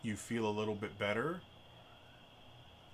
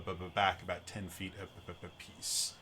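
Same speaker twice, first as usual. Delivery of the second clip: ordinary delivery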